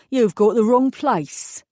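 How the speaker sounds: a burly East End mechanic taking over; East End accent. Acted. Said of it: "distinctly East End accent".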